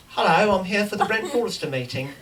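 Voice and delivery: put-on English accent, polite front-desk introduction. Visitor in wig introduces himself at the front desk, English accent. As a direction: announce yourself in an English accent. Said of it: "I'm speaking in an English accent".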